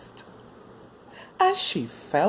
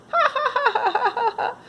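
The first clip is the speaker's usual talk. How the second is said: high, happy ha-ha laughter; boy's laugh; 3 dB louder than usual. Acted. Billy laughing while the puppy pulls him down. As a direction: laugh as you tumble into flowers. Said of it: "laughed Billy".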